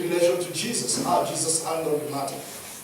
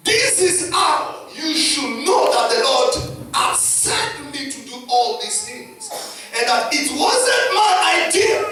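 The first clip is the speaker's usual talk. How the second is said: raised, slow, venomous declaration; Moses; 7 semitones higher, 3 dB louder than usual. Acted. Pastor reading Moses' challenge to the rebels, angry and shouted. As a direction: thunder it out like an embittered leader. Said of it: "You see how Moses is talking. Poison is spilling out".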